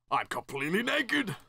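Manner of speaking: high, rising comic alarm; shocked realisation. Acted. Game character finding himself undressed, shocked and high-pitched. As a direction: cry it out in mock horror.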